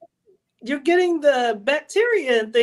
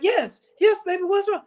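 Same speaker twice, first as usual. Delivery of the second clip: acted startled concern; 7 semitones higher than usual. Acts being woken at night.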